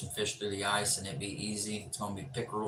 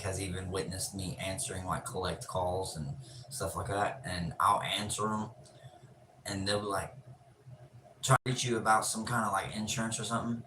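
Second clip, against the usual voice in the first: usual speaking voice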